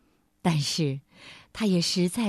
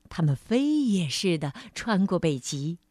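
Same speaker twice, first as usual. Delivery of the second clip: ordinary delivery, nothing marked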